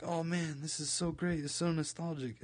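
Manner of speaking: bright mock delight at an old favourite; nostalgic gushing. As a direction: gush over a childhood show. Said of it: "I was like".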